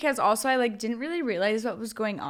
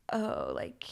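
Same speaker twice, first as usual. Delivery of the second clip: whispered aside; 7 dB quieter than usual. Retold or imitated. Dude's quote, whispered start.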